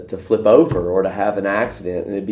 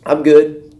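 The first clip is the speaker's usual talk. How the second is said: louder, higher offhand brush-off; breezy refusal; 6 semitones higher, 9 dB louder than usual. Retold or imitated. Stubborn man waving off the rescuers, loud breezy brush-off. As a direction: toss it off carelessly.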